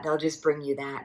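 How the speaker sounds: ordinary talk, nothing marked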